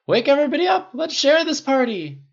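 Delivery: high, loud excited shout; calling out. A narrator's reading. Tony inviting everyone to the party, excited call. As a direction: rouse the whole house. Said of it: "Tony called out on sudden inspiration".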